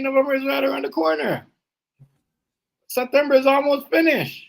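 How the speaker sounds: excited high rant. Real, not acted. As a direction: rant high and fired up about planning ahead.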